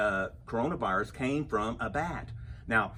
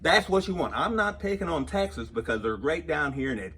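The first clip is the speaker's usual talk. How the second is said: ordinary talk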